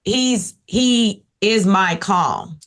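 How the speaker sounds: usual speaking voice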